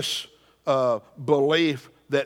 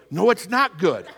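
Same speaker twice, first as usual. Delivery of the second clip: higher mock-indignant protest; mad king; 4 semitones higher, 4 dB louder than usual. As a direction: play a comically mad king objecting. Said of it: "he gets mad at the servant".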